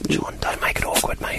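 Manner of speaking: urgent hushed whisper. Real, not acted. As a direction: plead under your breath to a mate. Embarrassed hushed plea.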